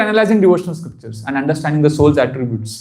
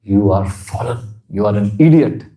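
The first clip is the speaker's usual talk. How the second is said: low, lightly voiced loving scold from Krishna; a hint of Krishna scolding; 9 semitones lower than usual. Shown as a demonstration. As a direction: speak Krishna's words low and fond. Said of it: "When Krishna tells you".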